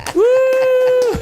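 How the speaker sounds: high loud woo like a cheering fan; crowd cheer. Pretend. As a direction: whoop like the arena crowd.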